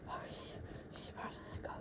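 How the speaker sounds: hushed unvoiced whisper. Real, not acted. Kid whispering while the dog is about to let go, anxious. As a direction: whisper so the dog stays still. Watching dog, afraid she runs.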